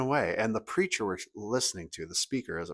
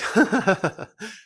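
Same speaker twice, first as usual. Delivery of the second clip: high, loud chuckle; imitated disbelieving laugh; 13 semitones higher, 6 dB louder than usual. Parents thinking she must be kidding, acted laugh. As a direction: laugh it off as a joke.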